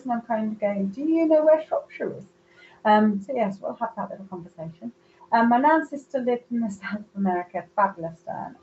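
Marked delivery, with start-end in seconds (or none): 0.9-2.2 high-pitched teasing comeback; playful tit-for-tat challenge; mock retort. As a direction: throw the question back, pitch lifted. Her teasing comeback to the spirit.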